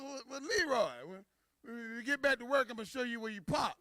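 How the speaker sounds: high, stammering boss; acted flustered high-voiced executive; company president. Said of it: "The president walked over to Leroy".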